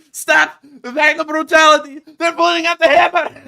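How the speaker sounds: very high, loud mock shriek of panic; panicked mock soldiers. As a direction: squeal it like a terrified soldier being pinned down. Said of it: "You hear them going".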